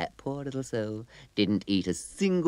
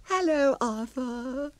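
very high trilled greeting; trilling fairy; slower than usual. Acted. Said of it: "trilled the fairy".

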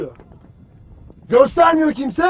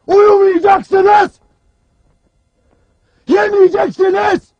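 shouted orders. Acted. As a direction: bellow each ban at the troops, stretching the words. Commander bellows orders at troops.

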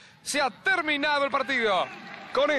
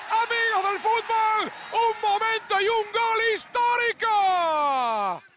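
breakneck goal commentary; 8 semitones higher, slower than usual. Acted. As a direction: shout the goal like a stadium commentator. Goal just scored, commentator erupts. (grito de gol eufórico y estirado)

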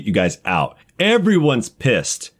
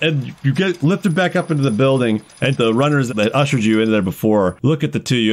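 usual speaking voice

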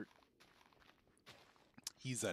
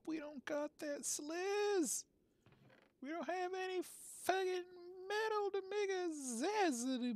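very high, slow, sing-song cartoon voice; squeaky, dragged-out goofball voice; silly high character voice; slower than usual. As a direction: play a dopey little character drawing out every word. Goofy character voice bit.

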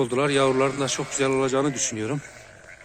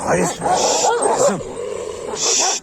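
high, loud hissed shush at the dog; sharp loud shush; 7 semitones higher, 5 dB louder, much slower than usual. Real, not acted. Owner hushing the barking female dog with a loud high shush.

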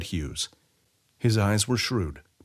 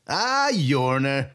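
Sai calling out; 5 semitones higher, much slower than usual. A narrator's reading. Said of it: "Sai cried out".

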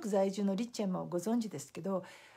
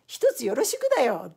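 president's punchline; 8 dB louder than usual. Retold or imitated. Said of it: "伺ったら社長が".

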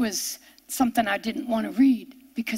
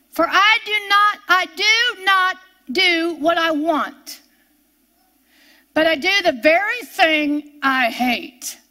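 scripture reading; 3 semitones higher than usual. Acted.

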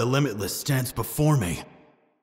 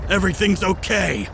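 normal talking voice

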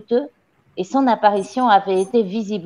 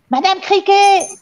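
high, loud summons; calling out; 10 semitones higher, 6 dB louder than usual. A narrator's reading. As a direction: call the cook from afar. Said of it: "cria mademoiselle Le Gourdin". (appeler en criant)

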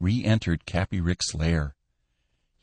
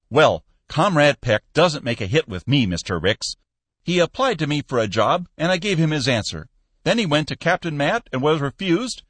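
lightly voiced stern manager, louder; stiff Skinner; 9 semitones higher, 4 dB louder than usual. Manager arguing with his boss, stiff and louder. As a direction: argue it stiffly.

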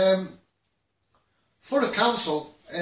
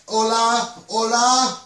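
bright, louder sing-song hola; playful Spanish hello; 3 semitones higher, 4 dB louder, slower than usual. Real, not acted. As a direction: call out hola cheerfully. Greets Teresa in Spanish.

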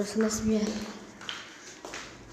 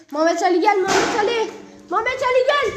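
loud frightened call, rising higher; scared calling out; 6 semitones higher, 6 dB louder than usual. Real, not acted. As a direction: call your friend in panic. Calling friend in fear.

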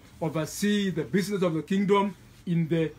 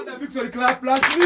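raised, loud cue to clap; rallying call; 4 semitones higher, 5 dB louder than usual. Real, not acted. Leader asking the congregation to clap along, rallying call. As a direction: call out over the crowd.

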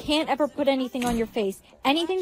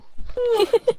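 high giggle; stifled amusement; 7 semitones higher than usual. Acted. Friends laughing at the pranked sleeper, high giggle. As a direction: giggle at someone high and brief.

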